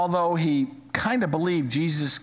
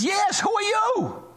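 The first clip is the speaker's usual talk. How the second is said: much higher, slower desperate plea from a man hanging off a cliff; panicked man dangling. Retold or imitated. Man hanging off a cliff calls up to a stranger, high and desperate. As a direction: call up frantically, voice pitched way up. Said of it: "And the guy looks up and says".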